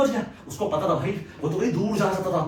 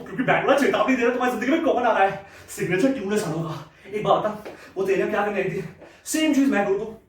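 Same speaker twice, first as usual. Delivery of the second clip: ordinary talk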